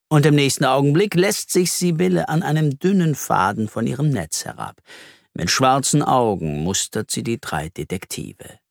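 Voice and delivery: ordinary delivery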